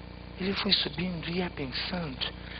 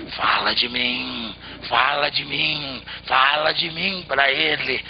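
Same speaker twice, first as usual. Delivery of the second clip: low, louder, slow insistent tree; tree, even stronger; 5 semitones lower, 6 dB louder, slower than usual. A narrator's reading. Tree insists the man mention it, low and loud. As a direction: press harder, low and heavy. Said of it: "ainda mais forte".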